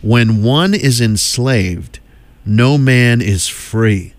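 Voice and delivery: very slow, each word spaced; slow title read. Shown as a demonstration. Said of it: "It's entitled".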